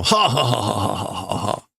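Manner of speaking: villain laugh. Acted. Grimmjow laughing again.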